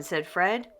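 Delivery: ordinary delivery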